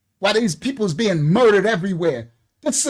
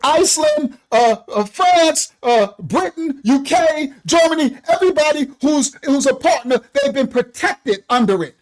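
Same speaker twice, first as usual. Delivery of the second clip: fired-up shouting; 2 semitones higher than usual. Real, not acted. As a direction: hammer it home, shouting. Heated rant defending the president.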